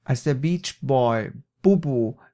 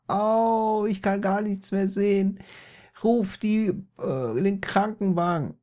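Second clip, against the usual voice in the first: high, hammed-up wail of pain; crying out; 7 semitones higher than usual. A narrator's reading. Blinded Peter begs for ambulance, high wailing cry. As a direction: call for help, high and overdramatic. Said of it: "rief er".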